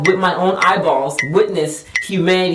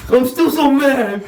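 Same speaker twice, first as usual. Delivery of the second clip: higher, put-on complaining voice; mocking husband's sulk; 5 semitones higher than usual. Wife imitating husband upset over his coffee, mock sulk. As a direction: mimic a partner fussing over a small grievance.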